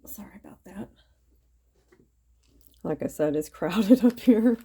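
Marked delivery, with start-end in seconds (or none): 0.0-0.9 soft unvoiced whisper. Real, not acted. Apologizing quietly mid-craft. 3.3-4.6 laughing. Real, not acted. Laughs heard on the line.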